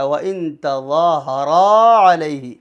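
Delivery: "تَظَاهَرَا" with zaa and raa both held long; stretched recitation. Shown as a demonstration. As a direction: hold the final raa long. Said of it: "را کے آگے الف ہے".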